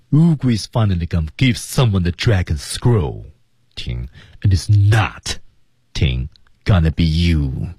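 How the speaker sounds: 在 scroll 和 not 后面明显停顿; 慢读，dragon scroll 后停，It is not 后再停; 两处停顿. Shown as a demonstration. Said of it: "停顿呢，我们看". (scroll、not 后各停一下)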